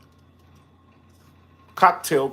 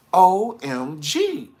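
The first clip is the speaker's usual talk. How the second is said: drawn-out OMG; 10 semitones higher, 5 dB louder, much slower than usual. Real, not acted. Reacting to the drama.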